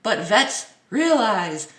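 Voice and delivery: dramatic headline read. Acted. Vlogger reading a clickbait headline, slow dramatic read. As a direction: read it like a teaser.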